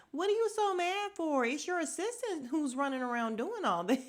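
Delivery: high, mock-baffled challenge; amused high-pitched mimicry; imitating lady in red. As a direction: question her in a high voice, breaking into laughter. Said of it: "had me cracking up when she's like".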